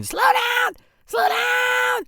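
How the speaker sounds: high panicked scream. Retold or imitated. Passenger in the back seat screaming at driver, panicked shriek. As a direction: shriek it, hanging on for dear life.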